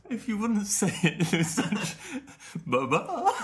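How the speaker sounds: high, loud giggly voice; words breaking into giggles; laughing while talking. Real, not acted. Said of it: "Stop laughing!".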